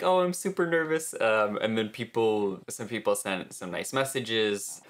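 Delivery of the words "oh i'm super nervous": mock nervous. Quoting own nervous Instagram post.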